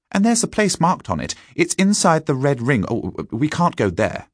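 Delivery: high, excitable schoolboy; schoolboy character. A narrator's reading. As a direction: pitch up as an eager boy scout. Narrator voices a boy.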